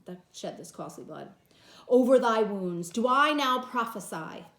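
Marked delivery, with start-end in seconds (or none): 1.9-4.4 loud stage declamation; dramatic reading. Acted. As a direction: proclaim over the wounds. Teacher reads Antony's speech dramatically.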